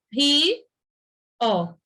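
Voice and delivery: "ri, ó" said as two separate beats with a pause; split into syllables. Shown as a demonstration. Teacher showing the class how rio splits, slow separate beats. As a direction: break "rio" into ri and ó. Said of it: "rio se separa".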